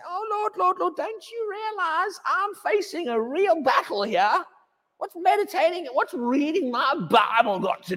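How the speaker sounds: high, pleading mock complaint to the Lord; mock whiny believer. Preacher mocking a complaining believer, high whine. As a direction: whine it like a panicky churchgoer.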